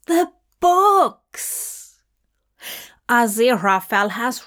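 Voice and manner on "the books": high, bright exclaimed cry of discovery; sudden realisation. A narrator's reading. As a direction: blurt it out as the idea hits.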